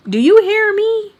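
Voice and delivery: loud, high-pitched confronting questions; imitating Barbara. Replaying the woman's challenge in her head, high loud imitation. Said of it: "Like that".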